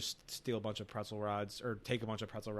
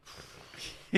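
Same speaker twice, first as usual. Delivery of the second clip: imitated puff of air; short voiceless blow; blowing into soda; 7 dB quieter than usual. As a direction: blow out audibly, as if into a drink. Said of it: "blows into it and says".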